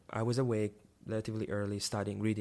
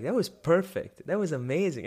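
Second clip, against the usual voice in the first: excited praise; 5 semitones higher, 5 dB louder than usual. Retold or imitated. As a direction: savor each word like it was perfect. Thrilled after the sermon.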